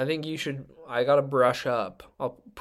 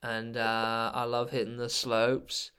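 put-on laid-back Australian snowboarder accent; slower than usual. Snowboarder introducing themself to podcast listeners, Australian accent. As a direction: put on an easygoing Aussie accent. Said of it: "I would like you to do your Australian".

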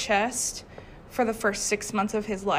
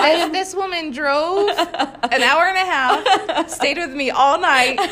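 laughing; 6 semitones higher, 6 dB louder than usual. Real, not acted. Laughing retelling.

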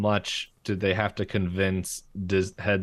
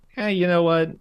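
Bill voice; 9 semitones higher, 6 dB louder than usual. Retold or imitated. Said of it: "he's like".

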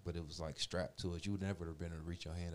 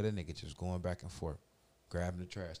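normal talking voice

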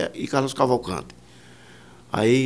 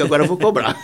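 says it through a chuckle; half-laughing as he names his price. Retold or imitated. To the studio owner, deciding to charge, laughing. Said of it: "eu digo".

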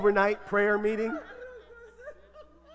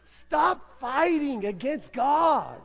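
slow exhortation; 3 semitones higher, much slower than usual. Real, not acted. Preached exhortation.

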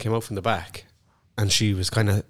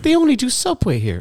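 high, puzzled imitation of the woman; confused customer voice; 3 dB louder than usual. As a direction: play a baffled customer, pitched up.

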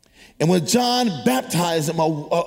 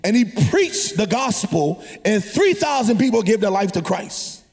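preaching; 7 semitones higher than usual. Acted. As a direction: preach it with rising fire. Long preached passage.

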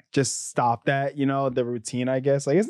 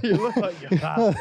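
laughing mock uncle; 8 semitones higher than usual. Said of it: "echoing friend's bit".

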